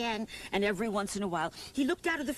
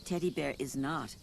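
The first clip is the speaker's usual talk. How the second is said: lightly voiced mother; 9 semitones lower, 5 dB quieter than usual. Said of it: "said Mother".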